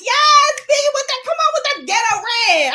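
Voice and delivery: hyped fan cheering a fighter's red hair; excited cheering. Retold or imitated. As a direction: cheer her on like a fan in the crowd. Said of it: "I was like".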